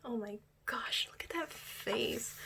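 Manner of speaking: slow hushed whisper; slow starstruck whisper; awed whisper. Real, not acted. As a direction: murmur it while staring at the photo. Gushing over idol photos.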